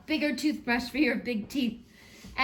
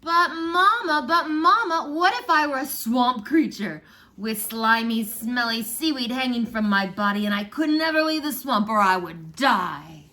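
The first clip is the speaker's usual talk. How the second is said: put-on child's voice; 3 semitones higher, 8 dB louder than usual. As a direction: whine it out like an eager child begging mama. Child asking mama again.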